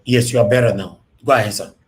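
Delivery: loud, clear phone voice; to someone hard of hearing. Real, not acted. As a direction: project it to a distant caller. Caller faint on phone line.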